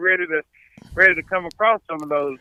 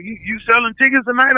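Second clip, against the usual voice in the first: higher, fast, insistent; pushy promoter; 8 semitones higher, faster than usual. Retold or imitated. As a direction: hammer the ticket question. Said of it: "He was like".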